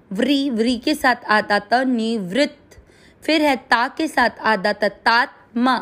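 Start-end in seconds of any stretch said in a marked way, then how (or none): none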